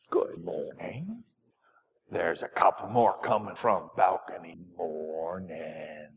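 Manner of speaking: whispered hello, barely any voice; soft whisper. Real, not acted. Pastor whispering good morning to the children at the font.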